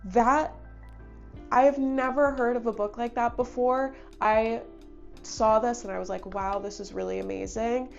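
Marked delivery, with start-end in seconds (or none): none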